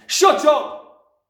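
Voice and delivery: explosive high-pushed bellow; angry dad at full volume; furious shout. A narrator's reading. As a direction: cut the child off with a roar. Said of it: "the father shouted".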